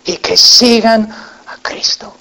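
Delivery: slow, breathless whisper of "sigan a Cristo"; hushed final plea. Acted. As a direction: whisper the invitation slowly. Whispered plea closing sermon.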